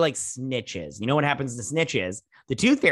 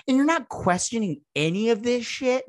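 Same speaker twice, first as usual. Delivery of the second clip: high incredulous demand; high outraged question; 9 semitones higher than usual. Retold or imitated. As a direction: shoot it up high in disbelief. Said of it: "I'm all like".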